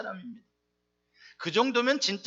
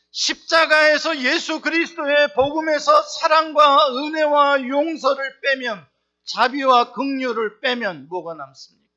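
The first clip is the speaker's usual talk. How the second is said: louder, slow shouted appeal; fiery preaching; 4 semitones higher, 7 dB louder than usual. Real, not acted. Pastor pressing the congregation on grace, loud and slow. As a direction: thunder it from the pulpit.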